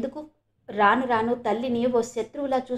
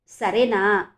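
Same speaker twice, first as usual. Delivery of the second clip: louder, pressing సరేనా? with suspicion; doubtful asking; 5 dB louder, much slower than usual. A narrator's reading. Father pressing his silent daughter again, suspicious. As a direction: repeat సరేనా? firmer, doubting her silence. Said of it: "అనుమానంగా చూస్తూ అన్నాడు".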